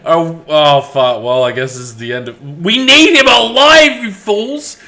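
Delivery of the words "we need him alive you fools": exasperated shout. Acted. Character to party about Kobe, exasperated shout. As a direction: call out urgently, pitched up.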